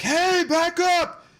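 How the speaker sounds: high, slow shouted order from a cop; mock cop shout. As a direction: bark it like a cop pushing the crowd back. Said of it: "the cops are like".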